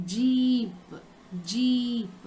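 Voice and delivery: "जीप" with a long held ī; pronunciation demo. Shown as a demonstration. Teacher modelling for children, drawn-out ī in "जीप". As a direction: draw out the ī in "जीप", then close on p. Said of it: "इसका pronunciation किस तरह करना है".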